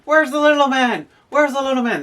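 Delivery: the Irish coach calling out loudly and high; Irish accent. Retold or imitated. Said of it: "in my best Irish accent".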